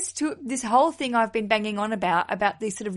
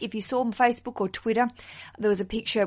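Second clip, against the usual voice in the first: ordinary talk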